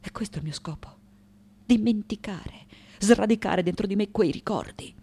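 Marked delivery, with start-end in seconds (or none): 0.0-1.0 sudden drop to very soft; quiet resolve. Acted. Sculptor stating her purpose, quiet resolve. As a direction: confide it under the breath.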